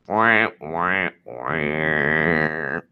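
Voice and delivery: low mocking whimper; mock crying. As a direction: fake baby wah wah.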